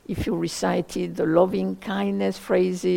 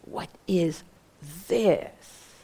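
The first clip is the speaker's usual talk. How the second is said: pause, then "this" lifted and drawn out; weight on the final word "this"; stress on THIS; 2 semitones lower, much slower than usual. Shown as a demonstration. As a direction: hold back, then land on "this". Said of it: "is maybe the this".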